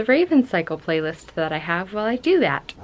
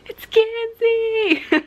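high, slow, delighted squeal of the name; excited squeal; 5 dB louder, much slower than usual. Retold or imitated. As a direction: squeal the name like a thrilled fan. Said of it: "And I was like".

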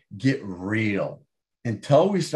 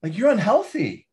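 a mock blunt mother, pitched well higher; imitating mom bluntly, voice raised high; 9 semitones higher than usual. Mom bluntly telling her son he's fat, higher mock blunt voice. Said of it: "my mom would be like".

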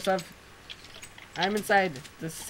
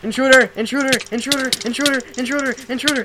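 high, loud, repeated alarm cries; alarm shouting; 8 semitones higher, 10 dB louder, faster than usual. Pretend. Player warning of a house intruder, alarm shouting. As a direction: shout it over and over like a siren.

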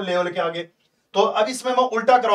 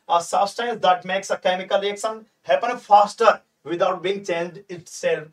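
slow dictation; much slower than usual. Shown as a demonstration. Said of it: "तुमसे पूछा जाएगा".

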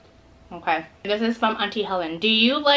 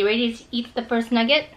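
ordinary talk, nothing marked

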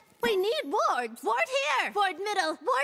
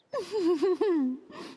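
character laugh; 2 semitones lower than usual. Acted.

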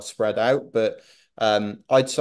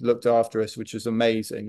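normal talking voice

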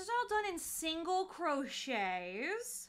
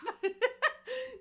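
shouting laugh; 9 semitones higher than usual. Real, not acted.

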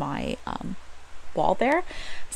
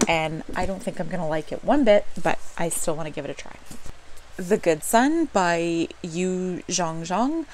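ordinary talk

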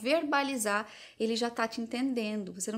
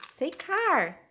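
quizzing command; 6 semitones higher, 4 dB louder than usual. Retold or imitated. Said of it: "em vez de você só dizer".

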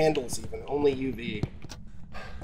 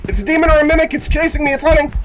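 panicked screaming, high and loud; scared horror game streamer; 14 semitones higher, 9 dB louder than usual. Real, not acted. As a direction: shriek it like you're being chased. Ghost hunting, screaming in panic.

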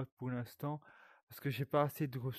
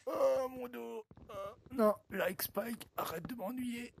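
much higher put-on whiny voice; squeaky tree voice; 11 semitones higher, slower than usual. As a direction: give the dying tree a high comic voice. Voicing the tree being cut.

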